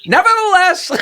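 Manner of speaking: nevertheless. Retold or imitated. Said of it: "And the announcer goes".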